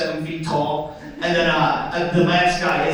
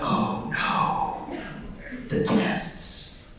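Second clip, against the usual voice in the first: whispering; 7 dB quieter, much slower than usual. Retold or imitated. Dreaded test, inner voice.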